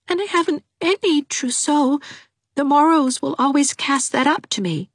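put-on weepy young girl; tearful Dovey. A narrator's reading. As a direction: wail her misery.

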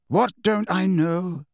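slow, even, unruffled question; calm old headmaster, unbothered; calmly. A narrator's reading. Dumbledore calmly answering the furious boy, slow and even. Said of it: "asked Dumbledore calmly".

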